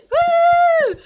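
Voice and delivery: very high, loud whoop; crowd cheer. Retold or imitated. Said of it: "You know, like".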